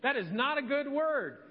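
hint of a stern mother; scolding mom. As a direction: lightly imitate a mom telling her off. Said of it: "She's like".

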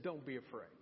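whisper-like gentle reminder; soft reminder. Retold or imitated. Preacher softly recalls Jesus' words to fearful listeners, hushed.

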